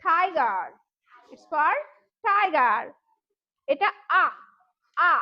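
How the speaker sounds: ordinary talk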